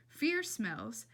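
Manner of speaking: ordinary delivery, nothing marked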